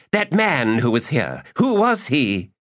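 high, pushy repeated question; demanding. Acted. Magus badgering the lady about the man, high and pushy. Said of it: "Iskaral demanded".

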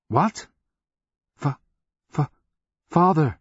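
high, startled, broken stutter; stunned, halting outcry; shocked stammer. A narrator's reading. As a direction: stumble over the word, then burst out high. Shocked stammer at seeing father.

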